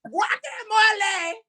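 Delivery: slow, shouted mock Spanish accent. Shown as a demonstration. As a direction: drag out each word, half-shouting, in mock Spanish. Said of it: "you sounded like a Spanish".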